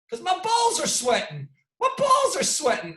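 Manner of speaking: high-pitched imitation of a fighter's complaint; mimicking Derek Lewis. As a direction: ham up the fighter's excuse. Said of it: "He goes".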